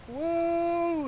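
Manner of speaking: long, high mock "whoa"; acted-out astonished bystander; imitating amazed cashier. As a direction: draw it out high, as a stunned onlooker.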